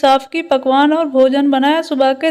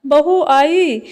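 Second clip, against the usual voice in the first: ordinary delivery, nothing marked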